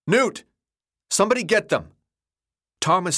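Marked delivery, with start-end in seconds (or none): 0.1-1.9 high, urgent shout for help; calling out loudly in alarm. A narrator's reading. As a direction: yell for someone to come. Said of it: "he shouted".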